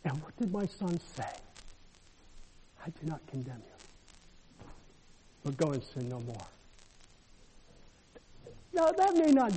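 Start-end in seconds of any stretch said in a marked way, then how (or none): 2.8-6.5 Jesus' gentle words. Retold or imitated.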